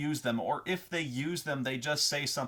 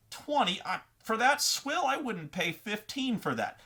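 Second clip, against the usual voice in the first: higher-pitched indignant haggler; acting insulted; 7 semitones higher than usual. Player haggling over a potion, acting insulted. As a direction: scoff at the price in mock offence. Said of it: "a lot of haggling is acting insulted".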